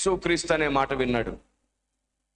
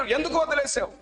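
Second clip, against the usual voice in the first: crying out; 11 semitones higher than usual. Acted. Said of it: "అరుస్తున్నాడు".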